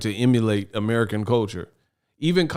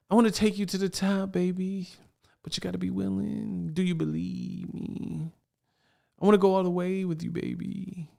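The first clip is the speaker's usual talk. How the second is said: higher, softer, crooning movie pimp; seductive blaxploitation hustler; 8 semitones higher, 8 dB quieter than usual. Retold or imitated. Host extending the blaxploitation parody, higher and softer mock seduction. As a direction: coo it like a seventies lover man, softer. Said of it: "they talk like this, like blaxploitation films from the seventies".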